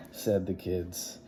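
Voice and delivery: ordinary delivery, nothing marked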